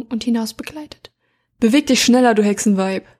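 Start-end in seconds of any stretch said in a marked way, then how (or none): 1.6-3.1 laut angeherrscht; angefahren. Acted. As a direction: grob angefahren. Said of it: "fuhr der Ritter zu ihrer Rechten sie an". (barsch angefahren)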